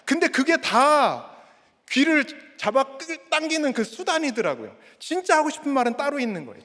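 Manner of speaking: ordinary delivery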